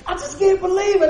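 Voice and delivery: high, loud exasperated outburst; retold meltdown outburst. Preacher retelling his own meltdown at work, a high loud frustrated outburst. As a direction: blow up at the table in frustration. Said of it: "I had a little bit of a meltdown".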